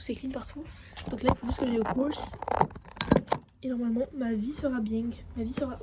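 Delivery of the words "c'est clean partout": whispered. Real, not acted. Showing clean flat.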